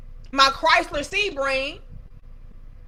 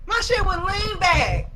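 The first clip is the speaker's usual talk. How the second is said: ordinary delivery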